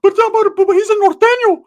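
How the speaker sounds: very high, loud stammering plea; exaggerated high-pitched imitation; Ricky. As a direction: squeal it like a protesting cousin. Ricky pleads for his friend.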